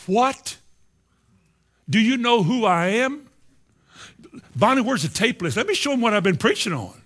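high, loud, affronted disbelief; indignant outburst. Acted. Preacher replaying his offended reaction to being told he isn't growing, indignant.

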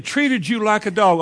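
usual speaking voice

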